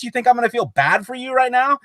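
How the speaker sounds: high, indignant retort; mock outrage. Friend voices the heroine answering her mother's killer, hammed-up outrage.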